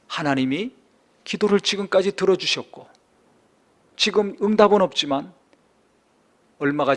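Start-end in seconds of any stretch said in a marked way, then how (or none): none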